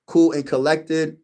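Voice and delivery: usual speaking voice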